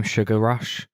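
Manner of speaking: usual speaking voice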